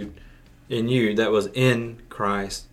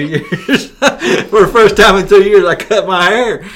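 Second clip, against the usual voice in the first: laughing burst, then the news louder and higher; laughing excitedly; 7 semitones higher, 7 dB louder than usual. Real, not acted. Preacher laughing as he tells listeners about his haircut, higher and louder. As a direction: blurt it out through a laugh, delighted.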